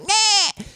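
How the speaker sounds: very high-pitched "nyah" blurt; mock taunting noise. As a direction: imitate an obnoxious pest waving a light. Mimicking an obnoxious person with light.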